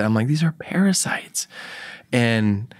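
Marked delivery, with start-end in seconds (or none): 0.3-1.5 hushed, voiceless whisper; father whispering to his small daughter, hushed. Retold or imitated. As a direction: breathe it out in awe. Showing daughter worms, whispered.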